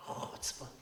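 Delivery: whispered, indignant "Chutzpah"; muttered outrage. Retold or imitated. Rebbe reliving anger at the defiant student, whispered. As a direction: breathe it out in disgust.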